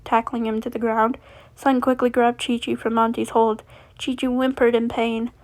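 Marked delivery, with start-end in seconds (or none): none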